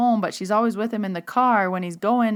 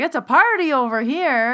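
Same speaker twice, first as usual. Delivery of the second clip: higher, louder mock cheer; imitated party mood; 6 semitones higher, 5 dB louder than usual. Medium voices client's mom with her cooler, imitated party mood. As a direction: voice a mom living it up on the porch. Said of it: "And she's just like".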